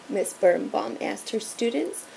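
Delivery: plain reading voice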